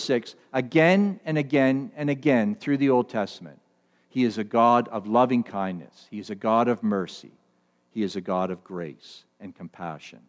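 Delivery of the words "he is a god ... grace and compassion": low, softening, reverent cadence; tender reverence. Real, not acted. Preacher assuring the congregation of God's loving kindness, tender reverence. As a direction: lower the voice and let each phrase settle gently.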